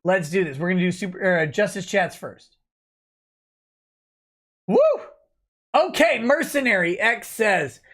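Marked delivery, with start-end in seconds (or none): 4.7-4.8 short whoop of excitement; excited woo. Real, not acted. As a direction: let out a quick cheer. Cheer before super chats.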